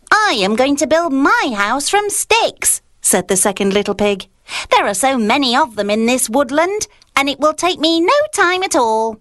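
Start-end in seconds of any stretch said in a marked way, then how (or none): 0.1-2.8 lightly raised little pig voice; a hint of a squeaky piglet; second little pig. Second pig speaks in story. 4.7-9.2 lightly raised little pig voice; a hint of a squeaky piglet; second little pig.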